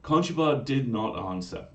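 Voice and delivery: usual speaking voice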